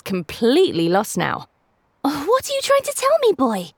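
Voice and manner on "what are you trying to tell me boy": put-on high young boy pleading; a kid begging his dog for answers; boy's voice. A narrator's reading. Obi asks the dog.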